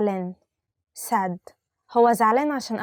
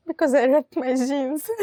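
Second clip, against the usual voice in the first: hammed-up whimper, slow and laughing; mock crying; 3 semitones higher than usual. Teacher play-acts crying over ripped jeans, laughing. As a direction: pretend to sob over the jeans.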